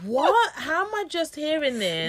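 high astonished question; stunned disbelief; shocked. Real, not acted. Shocked friend.

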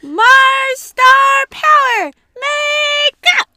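drawn-out, high heroic shout; very loud, slow, hammed-up power-up call; transformation battle cry. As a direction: belt it out like a magical-girl incantation.

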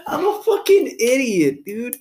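high, loud, helpless laughter through the words; laughing hard. Real, not acted. Mocking himself over his Spanish slip, laughing loudly. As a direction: crack up at your own stupidity.

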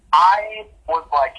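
ordinary delivery

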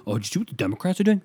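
high, fast mocking voice; mock eager gossip; mocking quote. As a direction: rattle it off like an eager partisan. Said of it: "they're like".

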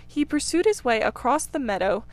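usual speaking voice

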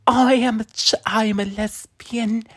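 slow, high, soft Dickensian orphan; hammed-up waif, drawn out and high; Mia Goth. Mocking a YouTuber's views, high orphan-child impression. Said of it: "She's like what Mia Goth sounds like".

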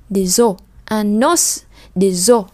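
des os said slowly, linking the z into os; slow liaison drill. Shown as a demonstration. Teacher slowly models liaison for students. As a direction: draw out the liaison. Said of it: "Một bên là N, một bên là Z".

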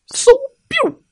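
very high squeaky whoosh and zap; a cartoon spell going off; magic zap sound. A narrator's reading. Narrator voices the zap as papa bear turns into a rabbit, very high squeak.

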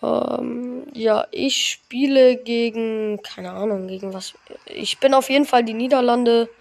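normal talking voice

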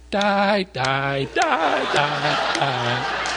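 toddler chanting one word, imitated; two-year-old. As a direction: chant it with pitch jumping like a toddler. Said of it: "our two-year-old at the time".

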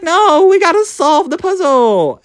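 high, slow put-on voice for the guide; mock the escape room guy, drawn out and high; storyteller voicing the room guide, high and slow; voicing Mart.

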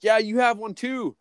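imitating pushy drinking buddies; egging someone on. Acting out friends urging a drink.

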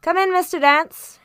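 high, grand lord of the manor; stately, condescending. A narrator's reading. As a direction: receive a visitor with lofty condescension. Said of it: "he said, very stately and condescending".

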